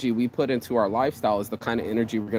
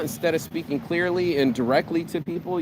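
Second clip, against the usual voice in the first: ordinary talk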